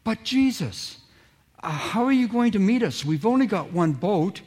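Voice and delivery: a disciple pointing out the snag; puzzled objection. Acted.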